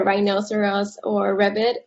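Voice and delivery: normal talking voice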